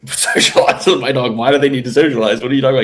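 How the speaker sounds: acted-out disbelief; mock bafflement. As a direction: imitate a baffled friend firing back questions. Acting out baffled Dan.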